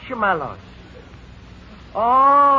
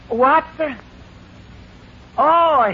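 ordinary delivery

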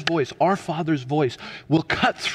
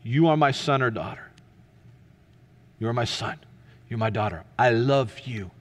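loving Father; 5 semitones lower than usual. Acted. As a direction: reassure gently, letting each phrase rest. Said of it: "Our Father says to us today".

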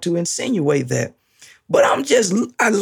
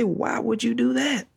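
doubting outsider; 5 semitones higher, 5 dB quieter than usual. Retold or imitated. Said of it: "Someone who doesn't understand God would say".

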